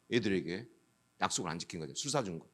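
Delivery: sheepish answering himself; quiet admission. Real, not acted. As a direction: drop the voice, owning the fault. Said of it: "quiet admission after question".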